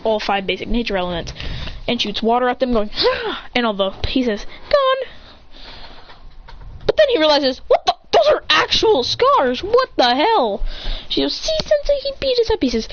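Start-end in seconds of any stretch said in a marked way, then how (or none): 3.0-3.5 short high-pitched "ah"; high acted yelp; high attack cry. Water attack cry in story. 7.7-10.6 high, drawn-out incredulous outburst; "what the hell" astonishment, acted; shocked disbelief. Character shocked by scars. 11.3-12.6 put-on very high-pitched girlish complaint; high put-on pupil voice; whiny tattling girl.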